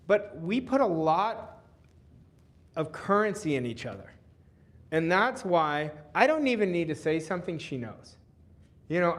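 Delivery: normal talking voice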